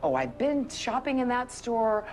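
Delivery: gushing New Yorker. Retold or imitated. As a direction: gush like a thrilled local. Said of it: "they notice that's the restaurant".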